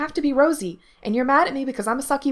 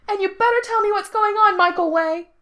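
high-pitched threatening holler; loud, indignant kid at full pitch; yelling; 8 dB louder than usual. A narrator's reading. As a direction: yell an ultimatum down the stairs. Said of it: "Gerard yelled".

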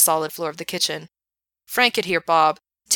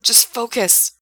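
desperate; 5 semitones higher, 4 dB louder, slower than usual. A narrator's reading. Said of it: "his voice desperate, demanding".

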